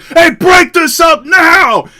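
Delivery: high, loud, slow shout to break it up; commanding shout. Retold or imitated. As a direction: bellow an order to stop the fight. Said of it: "he's like".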